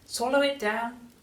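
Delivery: high, eager grandson voice; keen storybook child's cry. A narrator's reading. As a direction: call it out high, without much extra volume. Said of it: "George cried out".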